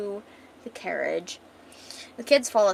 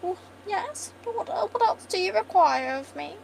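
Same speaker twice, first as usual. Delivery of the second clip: put-on high, fluting posh accent; posh queen; 11 semitones higher than usual. Posh queen asked a favor before they go, loftily posh. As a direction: trill it in a high regal voice. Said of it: "she's a posh bitch".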